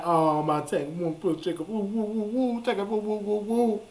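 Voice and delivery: soft, high mock wailing; imitated baby crying. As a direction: imitate crying babies with rising little woo-woos. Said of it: "they just be crying".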